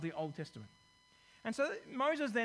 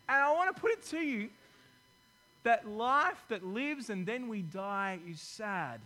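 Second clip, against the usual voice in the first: preaching emphasis; 4 dB louder than usual. Acted. Preacher presses the congregation, loud then slow. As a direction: drive the point home to the church.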